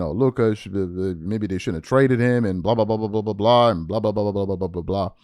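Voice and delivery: low, dragging mock of whiny critics. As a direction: drop the voice low and parody the doubters. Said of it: "Now they're saying".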